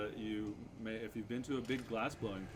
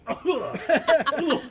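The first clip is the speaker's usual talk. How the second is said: mock groan through laughter; two hammed-up grunts, laughing; 17 dB louder than usual. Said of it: "and she goes".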